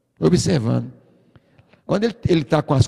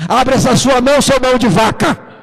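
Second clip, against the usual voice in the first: higher, louder retold kid's yell; child yelling; 7 semitones higher, 7 dB louder than usual. Boy shaming his stingy father in church, loud yell. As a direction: shout it out so the whole church hears. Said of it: "Ele falou assim pra todo mundo ouvir".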